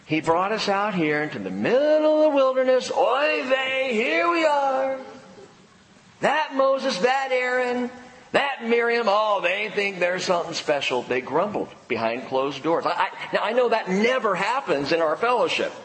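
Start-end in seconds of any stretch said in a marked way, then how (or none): 0.1-11.0 higher, kvetching complainer with oy vey; grumbling Israelite. Retold or imitated. Husband and wife grumbling in their tent, higher kvetching voice. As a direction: whine like a fed-up wanderer. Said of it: "they said".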